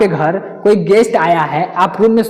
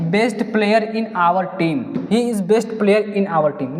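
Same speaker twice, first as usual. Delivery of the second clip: slow question read; much slower than usual. Shown as a demonstration. Reads question sentence slowly.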